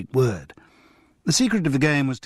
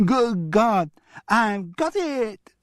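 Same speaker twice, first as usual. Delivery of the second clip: high, slow child mimicking a shout; mock shout; 10 semitones higher, much slower than usual. Julian mimicking his grandfather's outburst, high and drawn out. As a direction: shout it back like a cheeky kid. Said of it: "shouted Julian".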